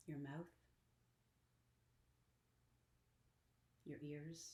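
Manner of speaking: soft, unhurried meditation voice with long pauses; guided meditation. Acted. Teacher leading listeners through Yoga Nidra to deeply relax, guided meditation. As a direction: guide them gently inward, hushed and even.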